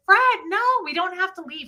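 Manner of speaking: wife begging husband not to leave, high and pleading. Retold or imitated. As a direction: beg him to calm down.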